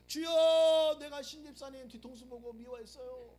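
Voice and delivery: hammed-up loud prayer wail; mock prayer cry.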